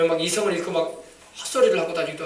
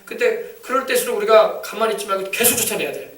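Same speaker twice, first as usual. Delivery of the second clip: ordinary talk, nothing marked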